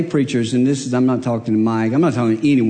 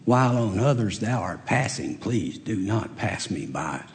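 soft, low, slowed-down prayer; hushed imitation of begging God for help; retold tearful plea; 6 semitones lower, 9 dB quieter, slower than usual. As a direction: plead quietly, as if recalling a private cry. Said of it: "just crying, saying".

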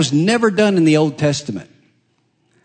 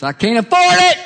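much higher and louder, comic despair; mock exasperated cry; 5 dB louder than usual. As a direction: howl it like a broke dad. Joke punchline, exasperated.

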